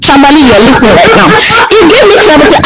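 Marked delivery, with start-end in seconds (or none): 1.7-2.6 laughing. Real, not acted. Laughing recalling teasing.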